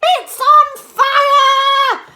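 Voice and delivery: high, loud, slow yell; high loud old woman yelling; Granny yelling. A narrator's reading. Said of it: "Granny yelled".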